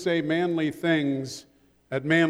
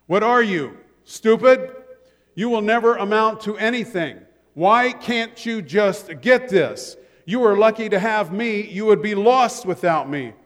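louder, higher mock belittling of a child; harsh parent; 4 semitones higher, 5 dB louder than usual. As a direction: cut down the kid like a mean parent. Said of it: "things such as this".

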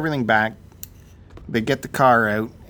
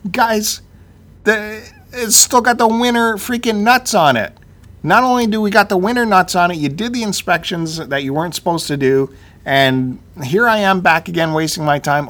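loud, high-pitched customer rant; exasperated complaint; 7 semitones higher, 6 dB louder than usual. Acted. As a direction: tell the shop off, fed up. Acted complaint on returning to shop.

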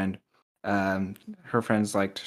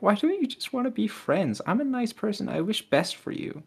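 high, sweet, girlish mock voice; girl's voice; 12 semitones higher than usual. Reading the girl's text aloud, high girlish voice. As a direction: pitch it up like a sweet girl. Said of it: "And she's like".

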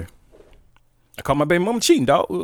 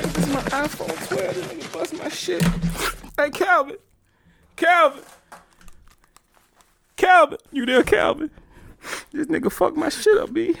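upset disbelief; 7 semitones higher than usual. Acted. Phone broken, calling Calvin.

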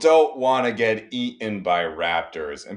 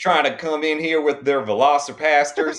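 mock Southern preacher; 7 semitones higher, 6 dB louder than usual. Mocking the cohost's angry Jesus freak, hammed-up Southern accent. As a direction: rant like a furious Southern Bible-thumper, hamming it up. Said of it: "awful Southern accent".

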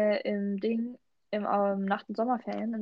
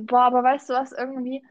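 something caught in the throat; talking through a frog in throat; 3 semitones higher, 8 dB louder than usual. Real, not acted. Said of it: "ich hab irgendwas im Hals".

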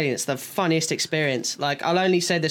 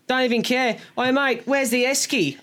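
higher-pitched tipsy Aussie impression; drunk Australian accent; 5 semitones higher than usual. Drunk Darwin workmate talking to her friend, imitated Australian accent. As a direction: imitate a pissed girl from Darwin. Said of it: "she was pissed".

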